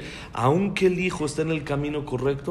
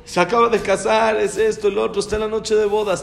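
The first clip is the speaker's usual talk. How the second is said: ordinary delivery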